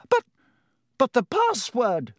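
high, faltering protest; stunned stammer. A narrator's reading. Professor McGonagall questioning the knight, stunned. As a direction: stammer in disbelief.

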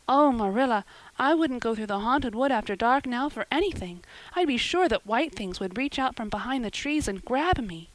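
put-on high, dramatic girl's voice; imaginative, frightened girl. A narrator's reading. Anne confessing the haunted wood game to Marilla, dramatic. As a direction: tell ghostly fancies with shuddering relish.

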